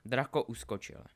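ordinary delivery, nothing marked

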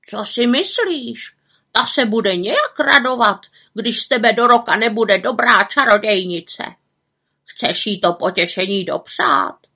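put-on raven voice, pointed; admonishing raven. A narrator's reading. Raven warns the witch of her aunt's gloating, admonishing. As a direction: nag like a scolding bird.

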